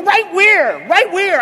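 high, loud, panicky repeats; mock hypochondriac panic; frantic question. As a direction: search desperately for it. Acts out frantic search for injury.

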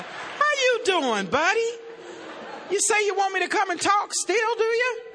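butter up the caller after the blunder; sheepish sweet talk. Retold or imitated. Sweet-talking the caller after gaffe.

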